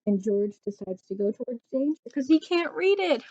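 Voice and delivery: plain reading voice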